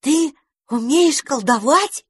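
high, slow amazed question; wide-eyed astonishment. A narrator's reading. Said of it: "изумлённо вытаращили глазёнки".

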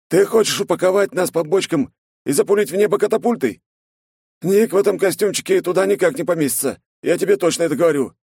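high, fast incredulous companion. A narrator's reading. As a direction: voice the friend, scoffing and quick. Snegir protests at length.

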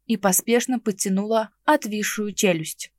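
plain reading voice

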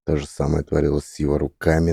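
ordinary delivery

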